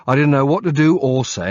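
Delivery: ordinary delivery, nothing marked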